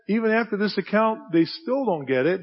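usual speaking voice

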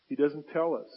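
normal talking voice

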